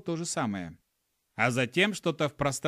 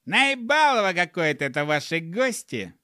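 loud, high-pitched disgruntled grumble; peeved gnome griping at full voice; grumbling gnome; 4 semitones higher, 5 dB louder than usual. A narrator's reading. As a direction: complain loudly in the gnome's put-on voice. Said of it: "пробурчал он".

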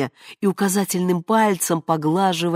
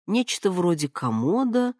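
ordinary delivery, nothing marked

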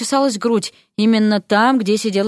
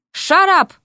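angry shut-up; 9 semitones higher, 3 dB louder than usual. Acted. Derek tells frog shut up.

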